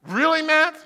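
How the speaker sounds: mock doubt from the audience. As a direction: listeners doubting the blind woodworker. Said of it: "some of you are doubting".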